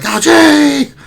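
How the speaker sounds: excited call. Retold or imitated. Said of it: "the fourth of Cog is like".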